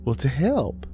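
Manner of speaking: high, gentle mother's voice; lilting, motherly answer; reassuring mama. A narrator's reading. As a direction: offer help as if it were obvious. Mother's ghost answers her.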